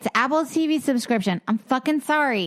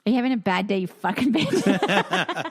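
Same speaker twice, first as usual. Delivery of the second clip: mock hostile. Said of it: "Not like".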